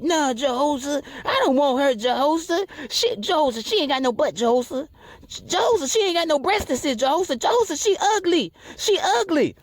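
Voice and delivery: high, whining complaint; whiny young guy. Retold or imitated. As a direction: complain like a picky young man. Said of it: "you be like".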